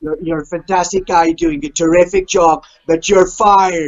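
slow, drawn-out Trump voice; Donald Trump impression. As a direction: deliver the firing like Trump. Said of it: "Donald Trump".

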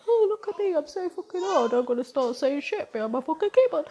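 high, loud sneering impression of a troll; mocking keyboard warrior. Mocking a keyboard troll, high sneering voice. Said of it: "some fucking twat is sat at home".

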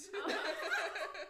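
high, slow, laughing "Melly Uzi Hart". Real, not acted. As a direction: laugh the name out, drawn out. Repeats name laughing.

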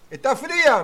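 calling out from the shore; retold shout. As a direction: shout the question across the beach. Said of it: "me grita el Diego".

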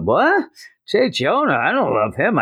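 high, startled squeak of denial; Spider-Man denying he loves Jonah, high startled squeak; surprised denial. Retold or imitated. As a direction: squeal it up high, caught off guard.

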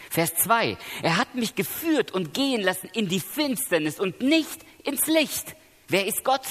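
high, impassioned preaching, voice lifted; preaching at full force. Acted. Preacher to the congregation about Jesus crying out, impassioned preaching. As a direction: raise the voice as Jesus cries out on the cross.

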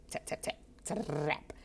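slow, voiceless tapping noises; imitated typing. Shown as a demonstration. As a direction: mimic keyboard clicks with the mouth. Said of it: "You know how it sounds".

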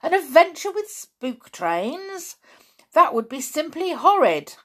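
high, aghast protest; horrified girl. A narrator's reading. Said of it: "said Anne in a horrified voice".

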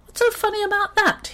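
very high squeaky, cross voice; indignant high-pitched mouse; cross little mouse. A narrator's reading. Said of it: "Horatio was even crosser".

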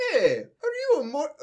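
high cartoon plumber voice; Luigi. First try at asking Mordecai, as a high-voiced Luigi. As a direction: pitch it up like an eager little plumber. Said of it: "Luigi asked".

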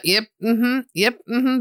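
absent-minded little acknowledgements; half-listening. Retold or imitated. Listener to a man who keeps talking, distractedly agreeing. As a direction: nod along without really listening. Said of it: "I'm really not engaging with him".

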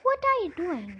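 high, loud goblin demand; squeaky goblin, caught out; suspicious goblin. A narrator's reading. Goblin demands an answer from Kirsty, loud and high.

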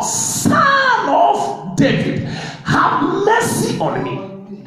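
high, slow pleading cry to Jesus; crying out for mercy. Retold or imitated. Blind man crying out to Jesus, slow high plea. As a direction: draw out each word, begging. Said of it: "Cry out the more".

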